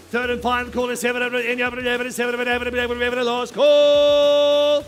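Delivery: auctioneer. Acted. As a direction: rise in volume for the last call. Last call building to hammer.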